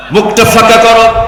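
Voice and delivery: high, loud repeat of the order; loud proclaimed command. Retold or imitated. Caliph telling Ali to open his mouth, loud proclaimed command. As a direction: throw the order out high and forceful.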